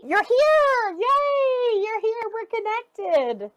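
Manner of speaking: very high, delighted cooing; the body thrilled to be noticed; overjoyed body voice. Pretend. Host voicing the body, overjoyed and delighted.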